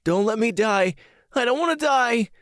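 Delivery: high-pitched acted panicked cry; terrified screaming. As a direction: scream out as a terrified boy pleading for life. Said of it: "started thrashing around in his bed and screaming".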